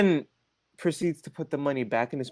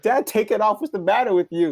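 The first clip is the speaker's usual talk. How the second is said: higher, raised telling-off; retold exasperation; 7 semitones higher, 4 dB louder than usual. Son scolds dad over the mask, retold exasperation. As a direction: scold him in mock exasperation.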